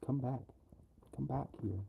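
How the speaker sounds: normal talking voice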